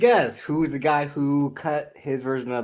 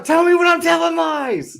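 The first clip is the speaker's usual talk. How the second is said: high, loud indignant shout; 11 semitones higher, 5 dB louder than usual. Real, not acted. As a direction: yell it in disbelief. Heated argument over heel pick.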